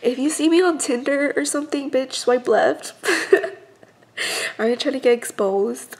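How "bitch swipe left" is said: ordinary talk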